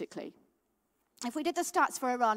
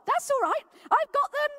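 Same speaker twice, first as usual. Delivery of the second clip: put-on much higher and louder, delighted old lady; cheerful old lady; 8 dB louder than usual. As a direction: brightly offer them like a beaming grandmother. Old lady offers Bibles.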